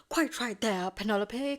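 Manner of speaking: loud, hammed-up assent; mock-hearty acted reply; emphatic agreement. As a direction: overdo the agreement, projecting. Acted Colin agreeing, flustered.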